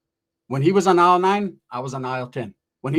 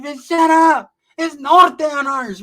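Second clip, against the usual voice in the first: high, loud shouting back; loud, high shout, last line slow; retold shout; 10 semitones higher, 8 dB louder, much slower than usual. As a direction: yell her down, slowing the last words. Yelling back at homegirl.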